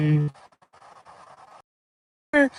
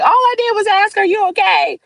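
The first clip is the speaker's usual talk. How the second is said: much higher, louder mock-baffled voice; baffled asker; 14 semitones higher, 3 dB louder than usual. Imitating a startled coworker who only asked, high mock bafflement. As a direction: squeak it out like a bewildered bystander. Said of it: "you're gonna be looking like".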